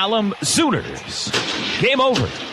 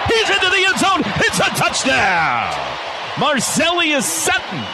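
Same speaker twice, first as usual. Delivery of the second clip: excited touchdown call; 5 semitones higher than usual. Real, not acted. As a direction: stretch out the touchdown. Touchdown called live.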